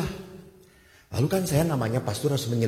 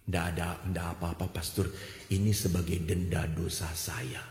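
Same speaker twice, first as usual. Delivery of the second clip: soft resigned voice; 8 semitones lower, 7 dB quieter than usual. Retold or imitated. Woman accepting abuse as penance.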